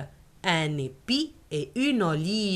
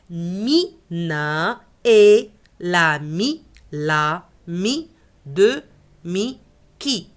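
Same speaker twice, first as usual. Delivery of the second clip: slow model sentence; 3 dB louder, much slower than usual. Shown as a demonstration. Teacher dictating the sentence slowly for the child, slow and rising. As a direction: stretch out Mina est l'ami de Mickey slowly. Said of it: "teacher slows model sentence".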